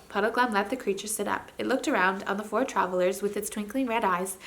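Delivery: usual speaking voice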